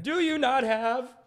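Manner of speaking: high, slow senatorial oratory; mock Senate monologue. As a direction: orate at the chamber, hammed-up. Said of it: "doing monologues like this in the Senate".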